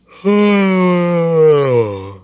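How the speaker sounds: long, loud, high yawn falling at the end; big yawn. Acted. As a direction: let out a huge drawn-out yawn. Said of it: "I was yawning".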